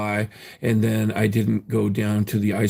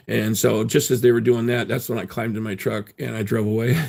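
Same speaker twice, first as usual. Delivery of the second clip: ordinary delivery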